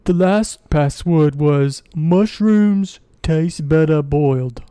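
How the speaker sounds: slow, loud dwarf voice; drawn-out, emphatic character delivery; duergar revealing the password. Acted. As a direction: recite it ponderously as a dwarf.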